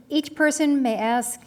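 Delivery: ordinary talk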